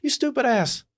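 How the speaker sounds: high mock yell; mock yell at wife. Husband mocking wife shoveling snow with a high yell. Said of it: "And I'm like".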